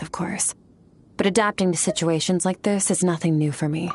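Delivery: ordinary talk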